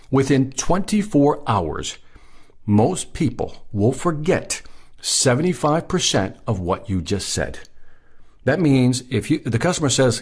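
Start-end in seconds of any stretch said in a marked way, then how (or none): 0.1-7.6 slow, deliberate repeat, broken into weighty phrases; slow for impact. Real, not acted. Trainer driving a statistic home to salespeople, slow and emphatic. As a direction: let each phrase land, pausing between them. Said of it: "I really want this to impact you".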